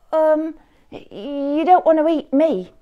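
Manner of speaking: high, hesitant goat; nervous goat voice. A narrator's reading. Middle goat talking the troll out of eating him, high and hesitant. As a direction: stall for time, a little scared. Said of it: "said Middle Billy Goat Gruff".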